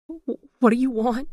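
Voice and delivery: terrified, voice climbing; stammered. A narrator's reading. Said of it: "she stammered".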